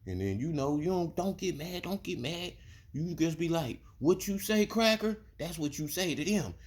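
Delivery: high put-on voice of the boss's son, coaxing; mocking the boss's son. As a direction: mimic him, high and sugary. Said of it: "voicing Boss Man's son".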